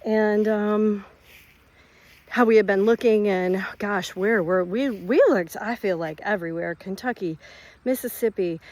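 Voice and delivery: normal talking voice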